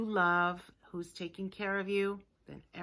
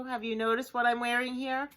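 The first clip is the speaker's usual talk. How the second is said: ordinary talk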